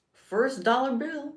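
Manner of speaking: hammed-up spoken-word delivery; mock poetry reading. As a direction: perform it like live poetry. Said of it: "I'm doing live poetry".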